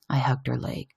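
ordinary delivery

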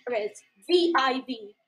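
normal talking voice

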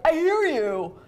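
high, loud, impatient I hear you with HEAR hit hard; snappy I hear you. Shown as a demonstration. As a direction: fling it back irritated. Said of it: "and not do it in a way like".

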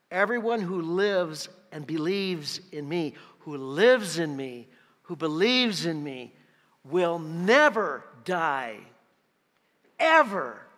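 slow, loud stress on "never" and "ever"; emphatic declaration. Acted. Preacher makes the congregation get Jesus' promise, slow emphasis on "never" and "ever". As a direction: drive home "never die", drawn out, then a loud "ever". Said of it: "Now get this next statement".

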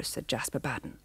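plain reading voice

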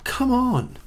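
high, whiny plea; exasperated pleading. Real, not acted. Player pleading after a bad enchantment, exasperated. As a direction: plead in high-pitched disbelief.

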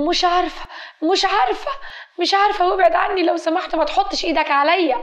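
put-on very high, agitated girl's voice; acted cornered girl fending off hands; panicky protest. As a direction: start high and speed into a frightened plea. Cornered girl protests being touched.

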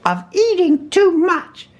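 very high-pitched scolding; stern Rabbit. A narrator's reading. Rabbit lecturing stuck Pooh, high and stern. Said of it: "said Rabbit sternly".

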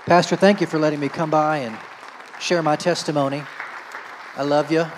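big booming gratitude; loud and fervent. Real, not acted. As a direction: call out your thanks. Loud thanks to pastor.

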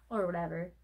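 normal talking voice

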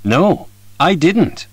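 No, I didn't with No and didn't hit strong, slowly; stress on no and didn't. Shown as a demonstration. Answering did you go there with stressed no. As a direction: punch No and didn't. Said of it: "Noとdidn'tの部分を強く言って".

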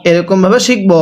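ordinary talk, nothing marked

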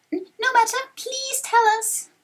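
high, eager little voice; pleading young hero. Acted. Young hero begs the sage.

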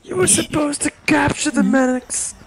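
high, slow anguished wail; mock tragic lament. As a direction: wail it like a betrayed mentor. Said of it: "parodied movie lament".

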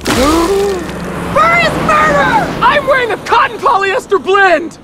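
high, loud panicked outcry; panicked shout. Acted. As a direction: scream it in horror. Panicked at ruined clothes.

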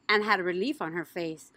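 plain reading voice

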